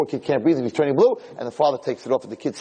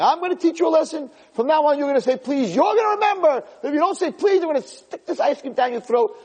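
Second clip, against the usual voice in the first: father yelling; 14 semitones higher than usual. Retold or imitated. Father screaming at his son to say please. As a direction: scream the threat at the kid. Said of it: "And the father's yelling".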